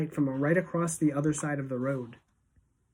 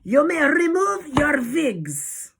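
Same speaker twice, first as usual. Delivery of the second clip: snarled high, slow command; Grand High Witch; 11 semitones higher, 5 dB louder, much slower than usual. A narrator's reading. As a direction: snarl loudly in a harsh foreign accent, W as V. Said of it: "snarled the Grand High Witch".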